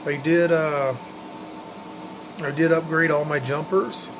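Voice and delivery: usual speaking voice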